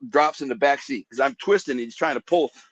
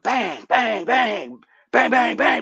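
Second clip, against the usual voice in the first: rising mock gunfire bangs, pitched up; imitating gunshots; 9 semitones higher, much slower than usual. Retelling how he shot at the robbers, mock gunfire bangs. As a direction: fire off bang bang like a gun.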